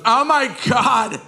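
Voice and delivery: lightly voiced appalled teenager; shocked teen girl. Sister's friend tells the quarterback what hit him, horrified. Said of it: "And Kristen goes".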